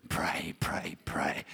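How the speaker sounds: soft whispered repetition; whispered mock prayer. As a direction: drop to a whisper as if praying nonstop. Mimes praying all day.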